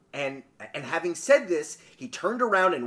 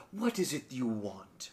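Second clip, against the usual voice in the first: soft, low and slow; mild and gentle; 6 semitones lower, 10 dB quieter, slower than usual. A narrator's reading. Genie asking the old woman her wish, mild and gentle. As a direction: ask it gently, slow and hushed. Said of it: "his voice was mild and gentle".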